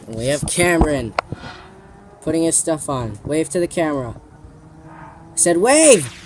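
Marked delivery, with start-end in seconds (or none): 4.9-6.1 slow, loud insistent shout. Real, not acted. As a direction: bark it out, drawn slow. Friends ignoring request to wave.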